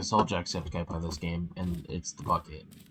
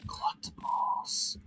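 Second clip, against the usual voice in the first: whisper; 4 dB quieter, slower than usual. Acted. Whispered menu reading.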